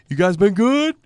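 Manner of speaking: lightly voiced rock singer teasing the crowd; a hint of the boss ribbing the audience. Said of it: "where it's like".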